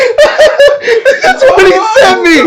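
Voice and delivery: high, loud laughing speech; words squeezed out through laughter; laughing hard. Real, not acted. Cracking up at letter.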